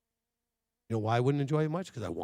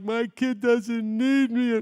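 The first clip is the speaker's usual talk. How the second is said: hammed-up whiny wail, higher and louder, very slow; mock crying mom; 10 semitones higher, 6 dB louder, much slower than usual. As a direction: play a self-pitying mother, mock sobbing. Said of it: "moms crying like".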